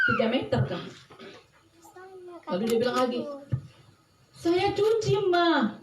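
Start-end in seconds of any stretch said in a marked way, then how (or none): 4.3-5.8 high-pitched imitation of a girl answering back; high young girl's retort; girl's protest. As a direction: protest in a light, high child's voice. Girl's reply, retold.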